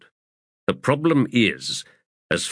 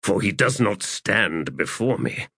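plain reading voice

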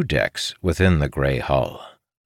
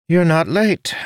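higher-pitched, surprised character voice; 13 semitones higher, 4 dB louder than usual. A narrator's reading. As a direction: say it with clear surprise. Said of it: "sounding surprised".

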